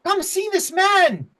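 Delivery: very high, loud gnome call; excited gnome. Acted. Gnome calls others to see visitor, high excited voice. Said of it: "You see, he goes".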